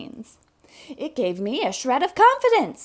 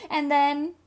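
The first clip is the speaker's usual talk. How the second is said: ordinary delivery, nothing marked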